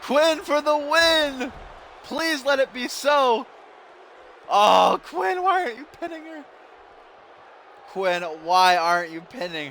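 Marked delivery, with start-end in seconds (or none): 0.0-3.5 pleading cheer. Real, not acted. Begging for a wrestler's win. 4.5-6.4 high, fast exasperated cry of why aren't you pinning her; quick high-pitched fan outburst; exasperated yelp. Real, not acted. Frustrated at wrestler not pinning.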